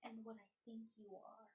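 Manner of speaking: low, very soft murmur, leaning in; hushed whisper. Acted. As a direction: say it under your breath, close to the ear. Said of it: "lean over and whisper".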